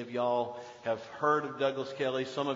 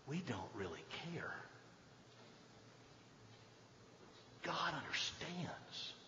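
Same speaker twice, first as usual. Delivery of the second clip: whispered; 3 semitones lower, 18 dB quieter than usual. Real, not acted. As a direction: drop to a breathy hush. Hushed close of the sermon point.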